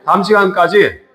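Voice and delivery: usual speaking voice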